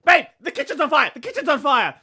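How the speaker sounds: high, loud panicky yell; retold shout. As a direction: shout a warning to the girlfriend. Yelling at girlfriend about the fire.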